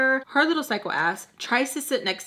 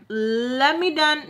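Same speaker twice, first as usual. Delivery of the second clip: sarcastic; 5 semitones higher, much slower than usual. Retold or imitated. Sarcastic retort to roommate's moment.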